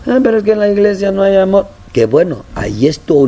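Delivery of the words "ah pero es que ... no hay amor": high mock whine; mock the complainer; whiny complaint. (queja quejumbrosa, aguda)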